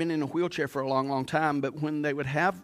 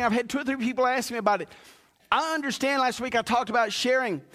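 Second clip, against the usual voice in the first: high, loud, driving preacher call; preaching at full force; 10 semitones higher, 4 dB louder than usual. Acted. Pastor urging the church to serve, preaching hard. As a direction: preach it out to the whole congregation. Sermon climax.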